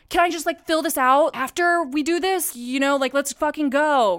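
high, urgent retold plea; exasperated. Friend pressing ER staff over paperwork, retold exasperation.

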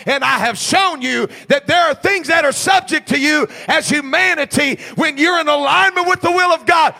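loud, climbing sermon cadence, every phrase hammered; full-force preaching. Acted. Pastor drives the sermon home to the church, full-force preaching.

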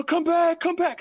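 high mock call after them; retold shout, pitched high; guard calling out. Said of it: "you hear".

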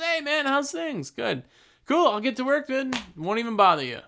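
relaxed tradesman. Retold or imitated. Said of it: "relaxed and chill".

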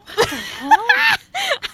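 shrill burst of laughter; shrieking laugh. Real, not acted. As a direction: howl with disbelieving laughter. Reacting to rude woman.

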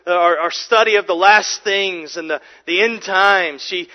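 ordinary talk, nothing marked

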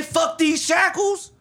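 loud defiant shout. Retold or imitated. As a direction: yell it like a rallying cry. Crowd rebelling, quoted chant.

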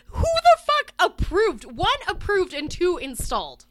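loud, high-pitched indignant demand; outraged disbelief. Real, not acted. Co-host ranting about who approved the button, outraged disbelief. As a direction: raise the voice in exasperated outrage.

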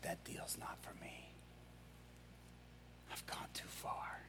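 barely audible, near whisper; hushed despair. Retold or imitated. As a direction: retell his hopeless admission under the breath. Prisoner despairs of being forgiven.

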